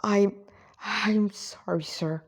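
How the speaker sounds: halting, slow apology; struggling with grief. A narrator's reading. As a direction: apologize softly, stumbling as grief gets in the way. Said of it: "the Reploid struggled with grief".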